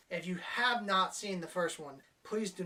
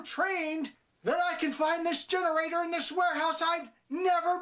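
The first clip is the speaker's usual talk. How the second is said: put-on much higher, louder sarcastic old man voice; mocking blind man; 9 semitones higher, 4 dB louder than usual. Reviewer mocking the blind man finding the generator, sarcastic mock voice. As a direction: ridicule the plot through a dopey mock voice.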